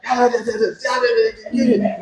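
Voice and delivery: high, soft muttered yeahs to nobody; muttering street guy. Retold or imitated. Guy walking down the street muttering to himself, soft and high. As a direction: imitate a man talking to himself on the street. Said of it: "they're like".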